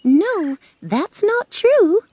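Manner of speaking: high storybook witch, drawn-out denial; correcting Good Witch. Acted. Good Witch answers Dorothy's belief about witches, correcting her. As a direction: stretch out a high-pitched correction.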